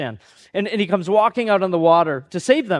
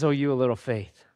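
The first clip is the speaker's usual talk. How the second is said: lower, softer gentle rebuke; 6 semitones lower, 5 dB quieter than usual. Retold or imitated. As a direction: chide him tenderly. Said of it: "he basically says".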